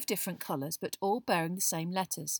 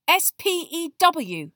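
loud, high, slow spelling in indignation; hot Hermione; 10 semitones higher, 12 dB louder, slower than usual. A narrator's reading. Girl correcting friend who mocked the name, heated. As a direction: spell each letter hotly. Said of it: "said Hermione hotly".

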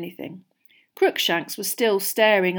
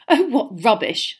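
louder, higher dismissal; impatient; 4 semitones higher, 6 dB louder than usual. A narrator's reading. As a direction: brush it off impatiently. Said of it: "said Hermione impatiently".

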